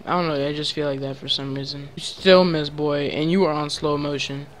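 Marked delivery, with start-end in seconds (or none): none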